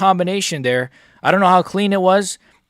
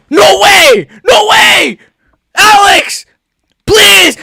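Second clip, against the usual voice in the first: much higher, much louder roaring; furious victory shout; 12 semitones higher, 8 dB louder, much slower than usual. Real, not acted. Fan screaming at the TV after Alex wins. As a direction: roar it at the beaten fighter. Celebrating, taunting the loser.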